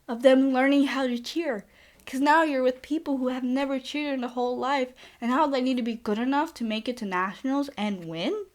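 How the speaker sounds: usual speaking voice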